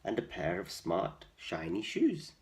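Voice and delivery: ordinary delivery, nothing marked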